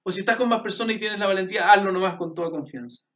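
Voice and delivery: rousing call to pray aloud; bold encouragement. Real, not acted. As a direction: urge them on with confidence. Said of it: "con fuerza".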